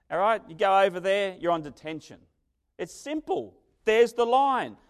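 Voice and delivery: loud, high, driving sermon voice; loud, high preacher drive; emphatic preaching. Acted. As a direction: hammer the point home to the congregation. Preacher acting out playground rules.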